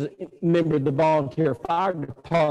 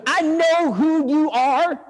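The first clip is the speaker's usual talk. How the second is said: high, slow put-on voice, cutting in; hammed-up indignant neighbor; hostile neighbor; 9 semitones higher, 4 dB louder, much slower than usual. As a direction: stretch each word like an irate crank. Neighbor cuts off the visitor.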